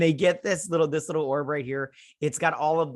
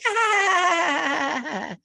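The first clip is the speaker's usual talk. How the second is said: mock wail. Crew yelling together in group catharsis, hammed-up wail. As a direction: wail it out in a big fake sob. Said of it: "And then they're like".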